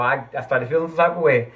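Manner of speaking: fired-up rant about putting up with nonsense; getting worked up. Real, not acted. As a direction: let real anger creep into the voice. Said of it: "I'm getting worked up".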